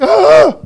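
loud, high mock scream; hammed-up terrified shriek; ghost-fright cry. Disciples seeing a ghost on the sea, crying out. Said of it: "thought it was a ghost and cried out".

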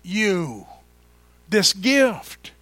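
very slow, loud, stretched-out proclamation; drawn-out preacher's declaration; slow emphatic preaching. Acted. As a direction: lay each word down like a gift. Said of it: "He said".

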